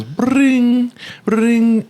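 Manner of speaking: imitated phone ring.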